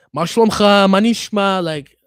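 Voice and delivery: loud, high Israeli street greeting. Retold or imitated. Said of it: "full on fucking Israeli".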